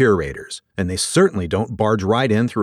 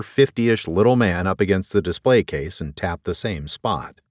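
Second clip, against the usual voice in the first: plain reading voice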